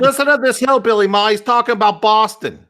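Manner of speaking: very high, loud, fast mock Bostonian; hammed-up Boston voice shouting to Ma; Boston local. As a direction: call out to Ma in mock outrage.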